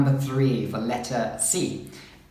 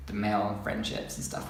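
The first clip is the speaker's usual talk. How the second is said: ordinary delivery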